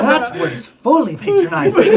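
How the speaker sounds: loud laughing, high. Real, not acted. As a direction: laugh through the words. Laughing at the joke.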